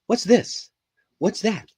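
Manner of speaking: put-on high, childlike questioning voice; daughter's question. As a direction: ask it like a curious little girl. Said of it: "My daughter loves to ask that question".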